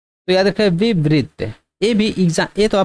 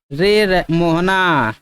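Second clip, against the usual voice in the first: drawn-out call; much slower than usual. Shown as a demonstration. Teacher stretching रे to show a long vowel, drawn out. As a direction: stretch the final vowels very slowly. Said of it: "तीन गुना समय तक खींच रहे हैं".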